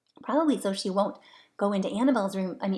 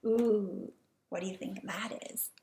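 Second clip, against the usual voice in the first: plain reading voice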